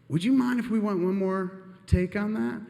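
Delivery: high-pitched, careful asking; imitating a timid ask to the boss; tentative request. Nervously asking the boss a favor.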